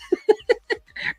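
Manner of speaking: short high-pitched laugh. Real, not acted. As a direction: burst out laughing. Laughs mid reading.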